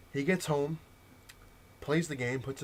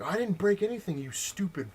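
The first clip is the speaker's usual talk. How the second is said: higher, louder mock tantrum, slowing on the insult; indignant retold outburst, pitched up; 9 semitones higher, 4 dB louder than usual. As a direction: imitate a kid flipping out at a clerk. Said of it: "So he flips out".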